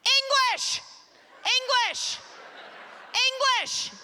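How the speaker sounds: furious mock protester chant; irate Anglophone shout. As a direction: bark it like an outraged local. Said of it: "this irate Anglophone going like this".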